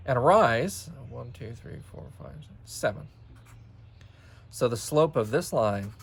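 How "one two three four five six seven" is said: very soft, barely voiced count; counting to self. Real, not acted. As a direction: count off privately under your breath. Counting grid units to self.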